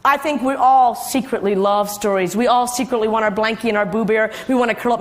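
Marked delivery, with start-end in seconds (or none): none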